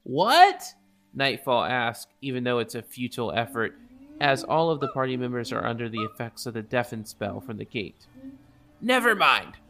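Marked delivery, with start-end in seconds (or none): 0.0-0.7 loud, high confused shout; shouted what. Acted. Nightfall can't hear, deafened. 8.8-9.6 loud. Acted. Nightfall gives up.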